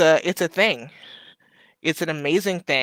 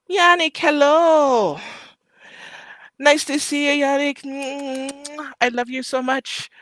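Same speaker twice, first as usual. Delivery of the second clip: high, excited squeal of affection; delighted greeting; 10 semitones higher than usual. Real, not acted. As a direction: greet a dear friend in a high, gushing voice. Delighted greeting of arriving friend.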